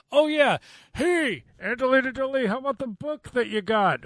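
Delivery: mock a partner nudging the heroine; mock character voice. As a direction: ham it up like a cheesy hint. Mocking characters' dialogue.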